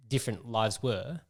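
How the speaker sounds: normal talking voice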